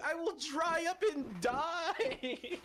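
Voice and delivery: high, slow, whiny lament; mock dramatic. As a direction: overdramatize the doom.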